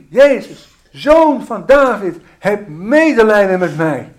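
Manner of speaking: loud, high cry for mercy; blind man's cry. Retold or imitated. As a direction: call out like a beggar begging to be heard. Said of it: "Zoals de blinde man in Jericho riep". (luide smeekroep van de blinde man)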